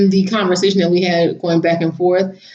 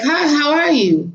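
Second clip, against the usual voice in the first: friendly hello; 8 semitones higher, slower than usual. Retold or imitated. Friendly greeting to the man.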